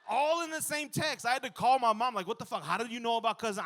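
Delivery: high, loud disbelief; shocked outburst. Retold or imitated. Calling his mom about the text, loud shocked disbelief. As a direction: throw up your hands in shock.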